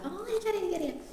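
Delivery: high, light, eager interjection; acted knowing chirp; know-it-all teacher. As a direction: jump in high as if you know it already. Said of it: "എല്ലാം അറിയാവുന്ന teacher".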